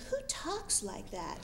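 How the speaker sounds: unvoiced whisper, mocking; incredulous whisper; whispered aside. Real, not acted. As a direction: breathe it out as a dry aside. Mocking aside after being yelled at.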